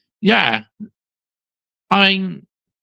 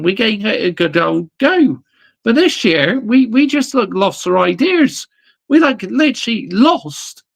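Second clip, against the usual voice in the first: angry rant; 5 semitones higher than usual. Real, not acted. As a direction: let the frustration out at the team. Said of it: "It needs to be a rant".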